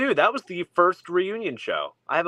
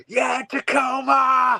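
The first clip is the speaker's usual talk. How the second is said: much higher, louder, slow drawn-out crowd shout; mock stage yell; 11 semitones higher, 4 dB louder, much slower than usual. Retelling [name]'s Tacoma show, a mock stage yell. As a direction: ham up a rock drummer hyping the crowd. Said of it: "he keeps saying".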